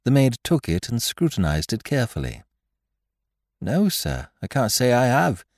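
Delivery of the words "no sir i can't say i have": chambermaid. Acted. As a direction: answer as an obliging chambermaid, voice lifted. Chambermaid's dialogue in narration.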